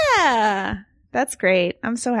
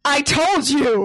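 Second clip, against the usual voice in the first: drawn-out, higher acted cry; mock alarmed shout; 6 semitones higher, slower than usual. Sarah proved right about the Terminator, mock alarmed shout. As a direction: shout it slowly in play-acted vindication.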